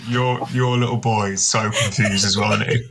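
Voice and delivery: ordinary talk, nothing marked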